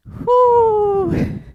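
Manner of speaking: loud high whoop; excited whoop. Real, not acted. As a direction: celebrate finishing the set. End of hard set.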